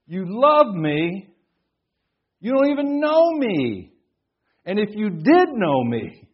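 high, loud, drawn-out disbelief; acted incredulity. Preacher recalling his thought at jail visitors, incredulous. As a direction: stretch the words out in astonished protest.

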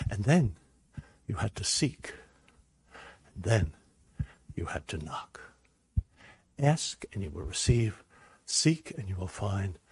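voice of God. Retold or imitated. God answering his servant's prayer, low and hushed. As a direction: speak as a calm God, sinking into a whisper. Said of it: "And he said".

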